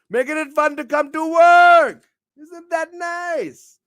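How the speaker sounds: high, loud showman yell, then stretched out; excited shout. Acted. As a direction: belt it like a hype man. Shouting host hype.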